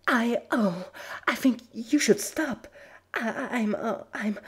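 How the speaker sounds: high, loud broken stammer; breathless stammer. Acted. Twilight struggling to ask Bubbly to stop, high breathless stammer. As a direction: force the words out through gasps. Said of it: "took all of Twilight's concentration".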